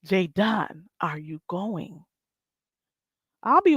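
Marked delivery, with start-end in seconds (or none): 0.1-2.0 soft, slow, drawn-out question; quiet slow ask. Acted. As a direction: ask it again softly and slowly. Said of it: "That's the real question".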